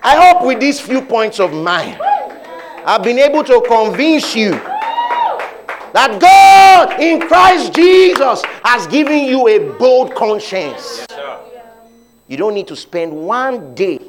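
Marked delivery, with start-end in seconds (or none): 0.0-11.1 loud rising preacher build; preaching. Acted. Preacher convincing the congregation, louder and higher. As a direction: preach it with full force.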